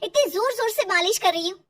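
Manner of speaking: high, loud, distressed protest; upset protest. Acted. Daughter-in-law protesting the rough massage, loud and high. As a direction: object loudly, voice climbing high. Mother upset over crying baby.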